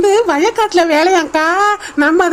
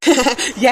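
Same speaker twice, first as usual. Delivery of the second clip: nervous giggle; 6 semitones lower, 4 dB quieter than usual. Acted. Nervous laugh.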